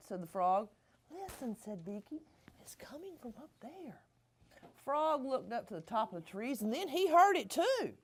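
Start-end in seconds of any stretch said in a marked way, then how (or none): 1.1-4.0 hushed whisper pointing upward; soft secret whisper. Acted. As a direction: whisper so the singer won't hear. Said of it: "he said excitedly".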